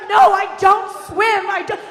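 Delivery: high, loud drawn-out refusal; hammed-up yelling mom; panicked mother. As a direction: wail a stubborn refusal.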